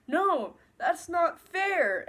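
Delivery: high, drawn-out mock protest; hammed-up teenage objection; whiny protest. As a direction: imitate a sulky kid objecting, slowly. Son protesting to his father.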